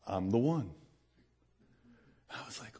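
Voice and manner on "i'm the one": low, calm voice of the One; the One speaking. Retold or imitated. The One answers the question in a low, calm voice. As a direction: answer simply, lower than your own voice.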